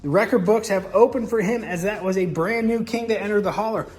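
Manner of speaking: crown the king like a grave master of ceremonies; solemn coronation host; solemn ceremony voice. Acted.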